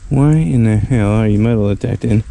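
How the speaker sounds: usual speaking voice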